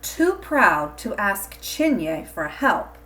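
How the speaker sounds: ordinary delivery, nothing marked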